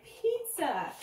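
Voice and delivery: high, drawn-out exclamation; eager child's answer. A narrator's reading. Danny telling the dentist his favorite food, eager.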